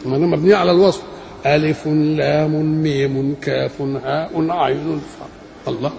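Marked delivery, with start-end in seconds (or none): 1.5-5.8 slow level chant, each letter name ending in -un, "alifun lamun mimun"; connected endings. Shown as a demonstration. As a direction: chain "ألف لام ميم" with a tanween on each name. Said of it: "كان على طريقة القرآن كان يقول ايه". (أسماء الحروف موصولة منوّنة ببطء)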